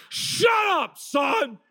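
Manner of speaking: high, hammed-up shout at a son; drawn-out, over-the-top parental yell. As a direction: bellow like a dad pulling rank. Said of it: "the louder I get".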